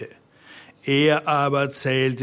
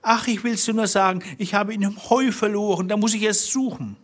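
high, quick, anxious confession; wife caught out, high and hurried; frightened wife; 9 semitones higher, faster than usual. A narrator's reading. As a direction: blurt it out high and fast.

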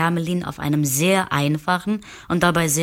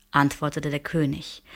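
plain reading voice

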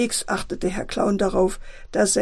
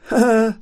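short, loud acted laugh; gloating laugh; 6 dB louder than usual. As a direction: laugh at your own naughty plan. Clown father gloats over planned mishap. (kurzes schadenfrohes Lachen)

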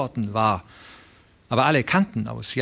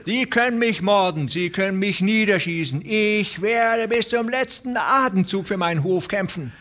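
much higher, fierce last-stand vow; defiant old rancher; 9 semitones higher than usual. Acted. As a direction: swear to fight to the last breath.

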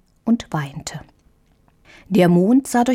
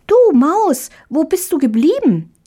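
put-on much higher, louder call of a small girl; calling little girl; 10 semitones higher, 3 dB louder than usual. A narrator's reading. Tiny Marie calls for the mouse, much higher and louder.

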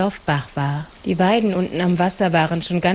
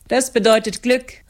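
calling out; 5 semitones higher than usual. A narrator's reading. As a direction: call it out to the bridal pair. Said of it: "riefen die Leute".

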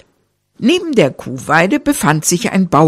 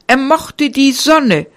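ordinary delivery

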